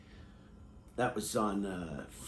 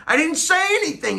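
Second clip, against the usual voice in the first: squeaky falsetto protest; high squeaky voice; 11 dB louder than usual. Shown as a demonstration. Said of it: "repeated in high voice".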